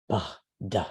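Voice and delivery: Buh, duh as two single breathy aspirated consonants; aspirated sounds. Shown as a demonstration. Teacher demonstrating Sanskrit aspirates to students, breathy puffs. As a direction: push a puff of air through Buh and duh. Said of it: "aspirated consonants like B H".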